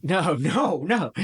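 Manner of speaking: ordinary delivery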